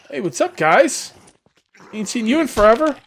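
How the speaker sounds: ordinary delivery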